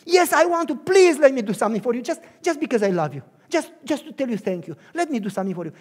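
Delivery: loud, fervent pleading; loud fervent grateful plea; impassioned gratitude. Acted. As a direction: beg to serve out of love. Pleading to serve.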